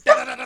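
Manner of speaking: high rapid-fire da-da-da; rapid punch noises. Pretend. Friend threatening whoever wakes him, fast punch sounds. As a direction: imitate a flurry of punches. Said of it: "goddamn fastest punch combination in the history".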